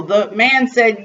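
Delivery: ordinary delivery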